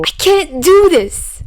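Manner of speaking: high, strained cry, slowed and stretched; a boy at breaking point, pushing the words out; panicked outburst. Acted. Peter fleeing the voices, high panicked cry.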